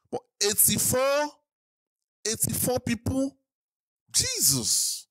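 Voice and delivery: high, slow incredulous repetition; appalled disbelief. Real, not acted. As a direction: echo it back in stunned horror. Repeats death toll in shock.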